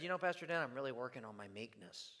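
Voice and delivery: mock meek murmur. Mock quote of congregant, joking.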